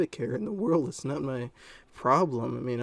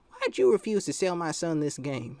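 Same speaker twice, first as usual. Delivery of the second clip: higher, put-on mother's voice; imitated mom; 5 semitones higher, 4 dB louder than usual. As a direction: mimic a customer's mom demanding answers. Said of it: "this is how she talked as well".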